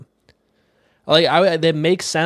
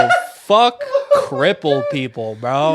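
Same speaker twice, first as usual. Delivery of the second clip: laughing mock rant; 4 dB louder, slower than usual. Joking mock outrage.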